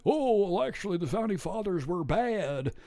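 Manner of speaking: dopey clueless mock Chomsky; dumb guy voice. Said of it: "he's doing the Chomsky voice".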